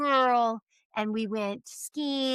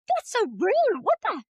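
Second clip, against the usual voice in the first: much higher, mock scandalized mom; shocked mom; 10 semitones higher than usual. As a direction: play the offended mother. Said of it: "I was like".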